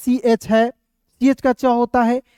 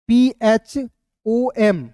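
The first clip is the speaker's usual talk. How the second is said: letters of पीएचओएम spelled one at a time, very slowly; slow spelling; much slower than usual. Shown as a demonstration. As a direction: draw out each letter for the class. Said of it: "इस तरीके से भी पढ़ सकते हो".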